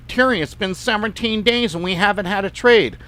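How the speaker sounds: complaining customer. Retold or imitated. Impatient client to trader about no trades, mock complaining.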